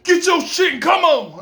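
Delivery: loud, high, rough shouted command; a guard yelling at the inmate; gruff guard order. Retold or imitated. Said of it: "He said it like this".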